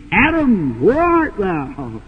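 low, slow, drawn-out call; deep, slow voice of God; God's voice. Retold or imitated. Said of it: "I can hear your voice speaking".

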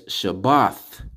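"Shabbath" slowly, with th at the end; final th. Shown as a demonstration. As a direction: draw out the th closing "Shabbath". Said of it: "So as you can see".

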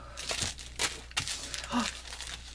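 long breathy gasp of delight. Real, not acted. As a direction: gasp in awe.

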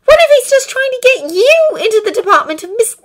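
high, loud, insistent urging; urgent high-pitched appeal; pleading. A narrator's reading. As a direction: argue desperately in a high voice. Acted pleading argument, girl character.

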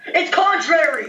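very high, startled outcry; dismayed exclamation. Real, not acted. Player realising the Malamar is contrary, high dismayed cry. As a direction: cry it out high as it dawns on you.

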